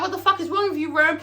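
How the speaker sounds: high, confrontational demand; angry challenge. Retold or imitated. Big Teak squaring up to Rome. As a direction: square up to him. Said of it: "he starts squaring up".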